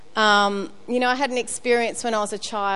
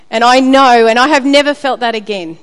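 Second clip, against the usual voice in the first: emphatic; 9 dB louder than usual. Real, not acted. Emphatic close of testimony.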